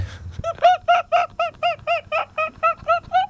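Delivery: sudden high-pitched burst of laughter; giggling fit, high and breathy. Real, not acted. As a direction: crack up at your own joke. Laughs after joking at a mate.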